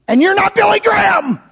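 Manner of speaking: high, exclaimed punchline; preacher's shout. Real, not acted.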